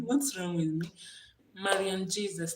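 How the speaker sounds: ordinary talk, nothing marked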